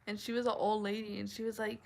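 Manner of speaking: ordinary talk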